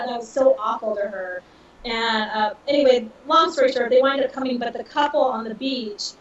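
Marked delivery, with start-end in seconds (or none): none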